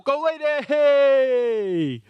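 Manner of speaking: high, loud, slow stretched yell; cup-winning roar; drawn-out triumphant shout. Real, not acted. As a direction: hold the name long in victory. Winning penalty save.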